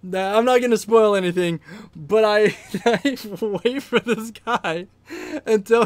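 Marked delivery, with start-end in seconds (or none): 2.8-5.8 slow, higher words squeezed through laughter; amused storyteller losing composure; laughing. Real, not acted. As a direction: try to keep telling it while cracking up. Laughs while telling the prank.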